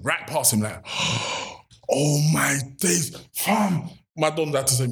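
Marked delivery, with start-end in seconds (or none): 0.9-1.5 gasp. Retold or imitated. Spotting the Superdry labels. 1.9-3.9 amazed. Retold or imitated. Amazed on seeing the factory.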